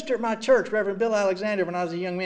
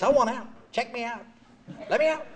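mildly raised, demanding; retold fury; 4 semitones higher than usual. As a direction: insist on leaving, a little heated. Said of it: "Oh, I'm furious".

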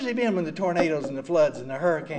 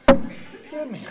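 a sudden loud, higher groan of despair; exasperated; 7 semitones higher, 7 dB louder than usual. Retold or imitated. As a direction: throw it up in mock agony. Reacting to dad's sayings.

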